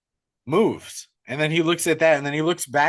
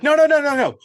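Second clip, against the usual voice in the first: high, loud, panicky protest; hammed-up frightened whine; mock panic; 10 semitones higher, 5 dB louder than usual. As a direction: imitate a scared streamer refusing to go upstairs. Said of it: "And he panics, bro".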